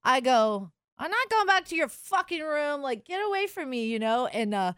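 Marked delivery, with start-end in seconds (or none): 1.0-3.8 high, sharp get-lost; telling the drunk guy off, indignant; indignant refusal. Retold or imitated. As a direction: shut him down hard. Refusing drunk guy's offer.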